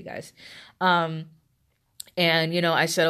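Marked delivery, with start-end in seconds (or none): none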